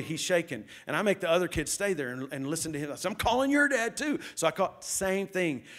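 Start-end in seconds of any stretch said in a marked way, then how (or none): 3.1-4.2 raised, high threat; stern warning, voice up; angry threat. Retold or imitated. Pastor warning the second boy he is calling his dad too, angry. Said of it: "I just go off on 'em".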